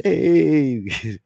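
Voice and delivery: high loud friendly hey; greeting the cop in his car, calling out. Retold or imitated. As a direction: hail him from the yard.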